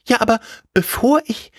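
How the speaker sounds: high-pitched begging character voice; pleading scarecrow. Acted. Scarecrow begs the tailor before coming along, high pleading. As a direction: beg eagerly in the high scarecrow voice.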